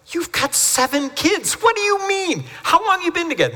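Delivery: high, stunned counselor asking the couple; incredulous. Retold or imitated. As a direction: pitch way up in astonishment. Counselor retells his shocked questions.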